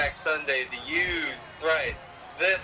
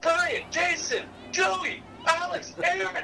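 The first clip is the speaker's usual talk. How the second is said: much higher, eager roll call; shouting names; 14 semitones higher than usual. Retold or imitated. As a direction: call out the names one by one, pumped. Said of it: "We just shout our names".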